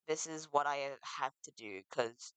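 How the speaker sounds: normal talking voice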